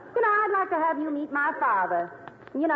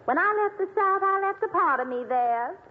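put-on Southern accent. Singer telling the comics she loves the South, Southern accent. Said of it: "I detect a little Southern accent".

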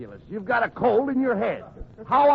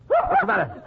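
sudden high alarmed yell; startled shout; 14 semitones higher than usual. Acted. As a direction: jump at the sneeze and shout. Reacts to a sudden sneeze.

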